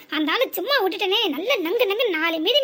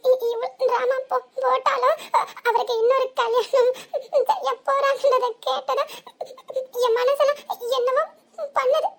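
choked sobs breaking through each phrase, voice raised; sobbing; 2 semitones higher than usual. Acted. A wife weeping over her husband's second marriage, sobbing. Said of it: "இப்படி ஏங்கி ஏங்கி அழுவுறா".

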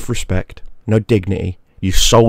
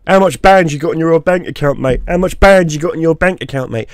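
mock British lad; 8 semitones higher than usual. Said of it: "turned around saying".

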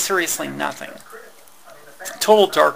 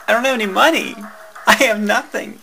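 higher, louder pleading; desperate plea; 5 semitones higher, 6 dB louder, faster than usual. Retold or imitated. Retelling her desperate plea to the store clerk, louder. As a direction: beg him like you have nothing left.

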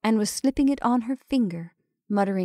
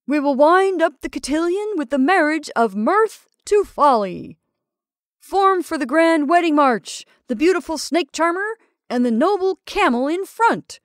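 higher, louder showman's call; rally the crowd into the march; ringmaster announcing; 7 semitones higher, 5 dB louder than usual. Acted. Ringmaster forming the grand wedding march, rousing. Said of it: "continued the ringmaster".

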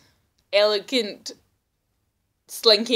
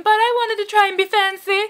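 high, louder posh-ish put-on; higher playful fancy voice; mock fancy; 11 semitones higher, 4 dB louder than usual. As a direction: sound pleased with your fanciness. Mocking her own fanciness.

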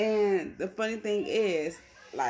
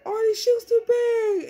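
high, loud wailing complaint; mock whining sister; 11 dB louder than usual. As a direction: whine like the shoes ruined everything. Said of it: "she was like".

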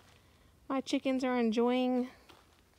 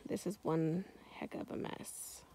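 whispering; 4 semitones lower, 9 dB quieter than usual. Real, not acted. Garden overgrown, whispered.

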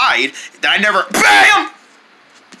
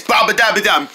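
normal talking voice

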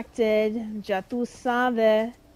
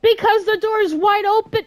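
higher, louder, incredulous snap; exasperated retort; 10 semitones higher, 6 dB louder than usual. Real, not acted. Gamer answering a character who feels unsafe, a higher, louder, exasperated retort. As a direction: fire it back in disbelief.

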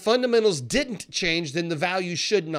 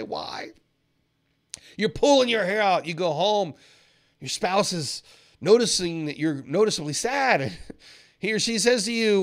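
ordinary talk, nothing marked

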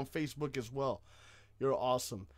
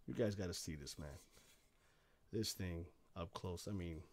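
hushed awe; 10 semitones lower, 14 dB quieter than usual. Real, not acted. Close-up quiet awe.

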